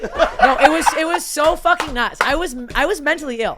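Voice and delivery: high, loud laughing disbelief; laughing at how crazy it was. Real, not acted. Laughing to friends about her own crazy trip, high and loud.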